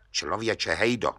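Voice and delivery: a judge weighing the tale; lightly voiced judge, calm and doubtful. The judge answers Hejda in disbelief, quiet and low.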